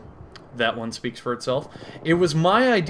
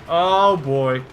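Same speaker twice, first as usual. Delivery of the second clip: higher, louder groaned exclamation; mounting dread; 4 semitones higher, 5 dB louder than usual. Pretend. Comic dreads confessing his next idea, louder mounting dismay. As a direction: swell into a louder cry of dismay.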